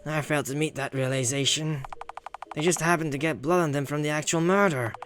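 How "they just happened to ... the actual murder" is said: ordinary delivery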